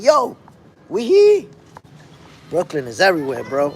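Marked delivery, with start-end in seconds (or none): 0.0-1.5 high, loud holler back; acted answering holler. Somebody from Brooklyn answering in Japan, high acted holler. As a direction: shoot up high, answering the call. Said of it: "somebody gonna be like".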